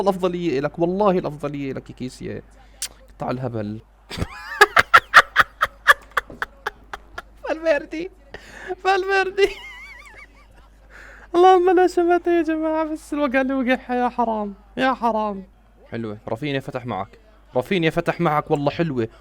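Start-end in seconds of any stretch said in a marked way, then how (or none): 4.1-5.9 very loud, high laughing fit; gleeful cackle. Real, not acted. Fan laughing at a rival's fall, high gleeful cackle. Rival player falls over. 7.5-15.5 high, squeaky words through laughter; gleeful laughing. Real, not acted. Fan gloating over Valverde's tumble, laughing high.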